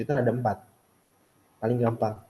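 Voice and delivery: normal talking voice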